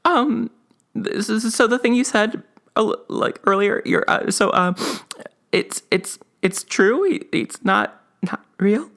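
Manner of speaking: put-on high, halting little-kid voice; nervous kid. Kid asking dad if Santa is real, nervously. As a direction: stammer it out like a worried child.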